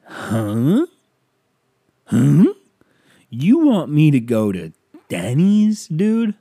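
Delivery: loud, high, rising disbelief; hammed-up affronted questions, climbing in pitch; incredulous outrage. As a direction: throw it back, loud and appalled. Acted outrage at the Denny's invitation.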